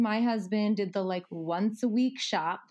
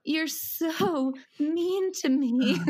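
whiny son; 7 semitones higher, much slower than usual. Retold or imitated. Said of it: "he's like".

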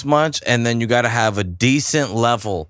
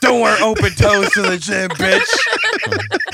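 higher, cackling mock telling-off; a jeering laughing jab, higher; laughing mock scold; 9 semitones higher, much slower than usual. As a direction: scold her while laughing at it. Mocking the angry lady.

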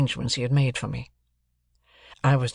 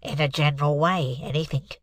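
stuffed-up cold. Acted. Client Mike answers the lawyer, bunged up with cold. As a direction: talk through a heavy head cold. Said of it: "voice of a sufferer from a constitutional cold".

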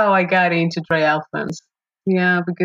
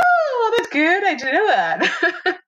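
laughing surprise; 11 semitones higher than usual. Retold or imitated. Learns it was a half distance.

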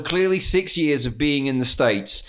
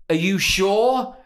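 slow, drawn-out "Are you sure?" said a little higher; slow pointed question; 5 semitones higher, slower than usual. Retold or imitated. Pointedly slow question to the drive-thru woman. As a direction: drag out "Are you sure?" to make her doubt. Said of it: "I said to her".

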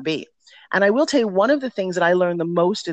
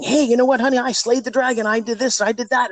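boastful triumphant brag; 6 semitones higher than usual. Retold or imitated. Wife boasting to husband at home.

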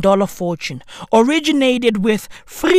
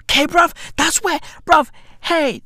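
ordinary talk, nothing marked

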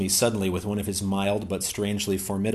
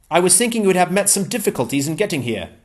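higher-pitched, eager English gentleman; Ransom's voice; 9 semitones higher, 6 dB louder, faster than usual. Acted. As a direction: pitch Ransom up, glad and keen.

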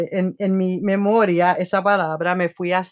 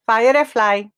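louder firefly in a New York English accent, voicing Siri; Siri's New York accent; 2 semitones higher, 5 dB louder than usual. Host voices Siri's New York accented reply. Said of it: "acento neoyorquino que yo tengo de Spanglish".

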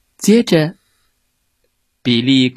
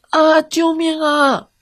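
high, drawn-out terrified wail; cry for help; slower than usual. A narrator's reading. Worm crying for help in the fox's grip. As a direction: scream for help in a panic.

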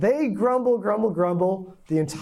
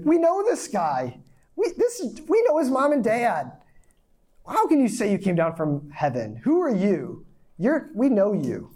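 incredulous crowd; 4 semitones higher than usual. Retold or imitated.

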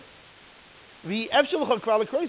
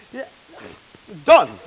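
a loud, weary burst on done; forcing cheer after the fifth take; fed-up fake yeah; 4 semitones higher, 13 dB louder than usual. Man on the fifth retake, faking excitement badly. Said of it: "you can't even fake anymore that you're, like, that excited".

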